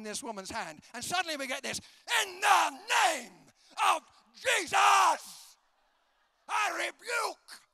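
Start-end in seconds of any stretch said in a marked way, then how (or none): 2.2-7.6 slow, high, booming shout; mock full-force rebuke, every word stretched; loud evangelist prayer. Evangelist friend praying aloud on a plane, hammed-up shout. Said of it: "why can't evangelists pray quietly".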